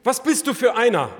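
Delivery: alarmed questioning. Acted. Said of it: "fürchtete er sich noch mehr".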